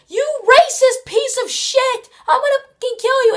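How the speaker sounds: imitating the friend's raging yell; furious yelling. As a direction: scream the threat at him, full rage. Friend's furious outburst retold.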